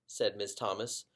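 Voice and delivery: ordinary delivery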